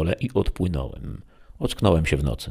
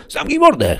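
loud, high-pitched yell; second robber shouting him down; shouted retort; 6 dB louder than usual. A narrator's reading. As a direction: yell back at your bickering partner. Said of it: "krzyknął drugi".

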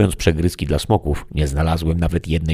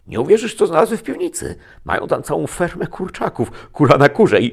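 high, fast, breathless excitement; bursting to tell a friend what he found in the cellar, high and quick; excited rush; 13 semitones higher, 7 dB louder than usual. A narrator's reading. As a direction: blurt it out, thrilled. Excited news of chickens found.

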